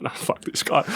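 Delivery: hissed under the breath; a whispered curse to himself; fed-up whisper. Retold or imitated. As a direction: mutter it, done with him. Fed up with pestering fan.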